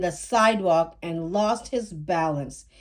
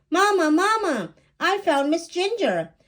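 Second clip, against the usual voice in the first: high, louder triumphant call; girl proud she found the cat; excited shout; 9 semitones higher, 3 dB louder than usual. Acted. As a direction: call out the good news.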